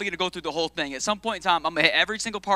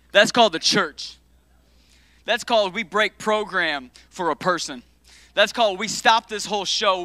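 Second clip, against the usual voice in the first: preaching at full force; 7 dB louder than usual. Real, not acted. Preaching builds, louder.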